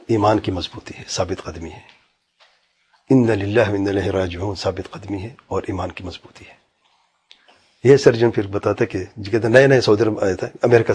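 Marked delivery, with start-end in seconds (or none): 0.1-6.6 soft, dropping voice that sinks almost to a hush; quiet solemn emphasis. Real, not acted. Preacher answers his own question softly, with quiet conviction. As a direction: lay the moral down softly, with conviction. Preacher drives home the lesson.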